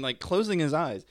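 usual speaking voice

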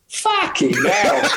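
slow Cockney swear, half laughing; laughing Cockney curse; Cockney accent. Retold or imitated. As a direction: drop into a Cockney mate's voice and drag it out. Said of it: "in the perfect Cockney accent".

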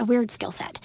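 soft voiceless aside; a whispered side remark. Real, not acted. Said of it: "aside while explaining".

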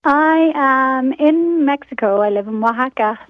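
ordinary delivery

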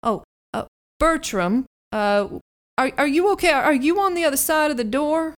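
high, loud worried call; Gregor calling out. Acted. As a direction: call through the door, worried.

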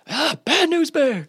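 high, drawn-out mock scream; Philip Rivers screaming. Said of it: "you know, just screaming".

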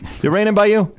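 a hint of a fussy grandmother on the phone; grandma calling. Grandson imitating his grandmother's calls, light mock grandma voice. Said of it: "this would happen".

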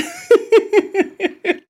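sudden high burst of laughter. Real, not acted. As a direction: laugh out at your own joke. Laughing at her own remark.

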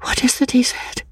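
a high, breathless whisper, searching memory; whispered to herself. A narrator's reading. Confused woman whispering to herself, trying to remember. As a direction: murmur it to yourself, lost. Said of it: "and whispered to herself".

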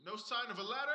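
distant, soft, from up on a roof; calling from far above. Acted. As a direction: call down from the rooftop, far away.

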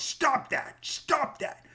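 high, sharp mock scold; mock high-pitched stop that; imitating attorney snapping. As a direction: snap it like a scolding lawyer. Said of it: "He goes".

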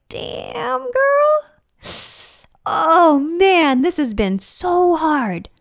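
high, slow, tender talk to oneself; self-talk in the mirror. Pretend. Speaking to herself in the mirror, high and gentle. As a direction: comfort yourself like a close friend. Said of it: "look yourself in the eye, in the mirror and be like".

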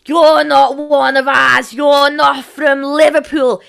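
mock a snob's Scouse, drawn out and loud; hammed-up posh Scouse shout; snobby Scouse. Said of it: "snobby Scouse".

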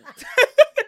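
loud, high squeal of laughter. Real, not acted. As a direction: shriek with laughter. Laughing fit on podcast.

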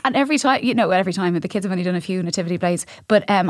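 usual speaking voice

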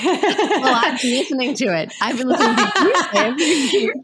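speaking through a laugh. Real, not acted. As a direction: laugh while saying it. Laughing after guest's joke.

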